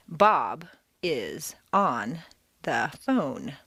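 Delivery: "Bob is on the phone" very slow, a pause after every word. Shown as a demonstration. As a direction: separate each word of "Bob is on the phone", each falling. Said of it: "Do not speak word by word".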